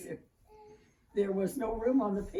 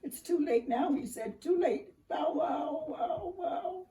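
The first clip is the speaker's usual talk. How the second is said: high, louder anguished wail with barks; despairing cry; 8 semitones higher, 3 dB louder, slower than usual. A narrator's reading. Little dog crying out that it is too late, high and loud. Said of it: "he cried".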